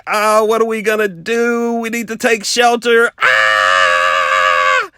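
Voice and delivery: panicky, loud, rising cry ending in a yell; mock panic as the storm closes in; freaking out. Nervous storm chaser panicking in the car, loud mock fright. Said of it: "I'm freaking out".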